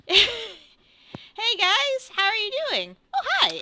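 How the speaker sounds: very high, loud hello; excited greeting. Acted. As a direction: greet friends with bubbly, high-pitched excitement. Master greets his visiting friends.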